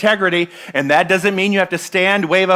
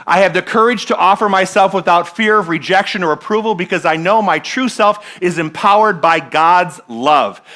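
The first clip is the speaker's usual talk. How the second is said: preaching affirmation; 3 semitones higher, 5 dB louder than usual. Acted. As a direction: declare it with conviction.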